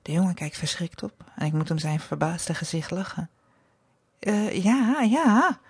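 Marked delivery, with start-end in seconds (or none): 4.2-5.6 hesitant, higher wavering 'ja'; stammering. A narrator's reading. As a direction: falter over a startled yes. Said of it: "stottert hij". (stotterend, geschrokken)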